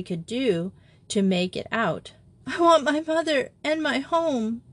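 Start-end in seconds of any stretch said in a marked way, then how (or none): 2.4-4.6 put-on homesick girl, tearful and high; sobbing. A narrator's reading. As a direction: plead through tears. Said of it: "sobbed Simone in French".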